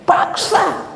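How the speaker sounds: very high, emphatic single word; forceful command. Acted. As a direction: fire out an insistent order. Corrects translation with forceful command. (seruan memaksa bernada sangat tinggi)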